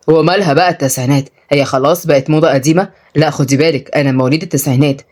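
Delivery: low male voice; mildly affronted young man. Acted. Tutor defends his nineties birth, low and affronted. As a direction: keep it low, gently defensive.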